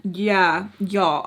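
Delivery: normal talking voice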